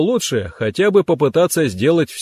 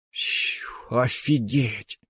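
soft, drawn-out awed exclamation; whistling amazement; 8 dB quieter, much slower than usual. A narrator's reading. Vova hearing of the millions saved, whistling in awe. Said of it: "присвистнул Вова".

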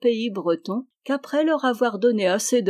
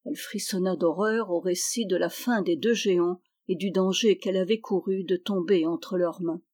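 plain reading voice

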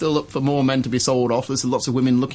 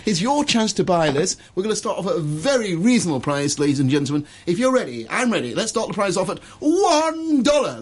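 high, bright auction-room announcer; auctioneer patter; 7 semitones higher than usual. Shown as a demonstration. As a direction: sell the mug to the whole room. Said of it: "should we auction something".

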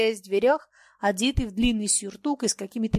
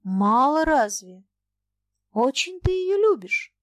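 slow, louder hopeful question; drawn-out question with a touch of hope; 4 semitones higher, 5 dB louder, slower than usual. A narrator's reading. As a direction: ask slowly, hoping for reassurance. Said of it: "с надеждой спросил".

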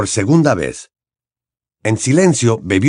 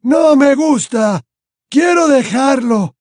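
high, slow, pained wail; anguished old man whimpering; moaning; 4 dB louder, slower than usual. A narrator's reading. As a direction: moan and plead to stop. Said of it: "gimió Dumbledore".

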